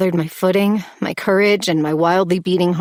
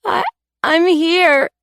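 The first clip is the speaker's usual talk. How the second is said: faltering answer; 11 semitones higher, 4 dB louder, slower than usual. A narrator's reading. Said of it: "I said".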